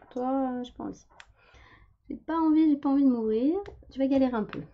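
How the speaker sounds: high, playful talking jar voice; voiced paint pot. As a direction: voice the stubborn pot refusing. Paint pot voiced refusing to open.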